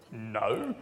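low laughing no; low, laughing denial. Acted. Answering her, laughing.

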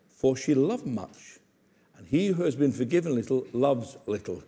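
solemn verdict. Acted. Solemn verdict after "bombshell.

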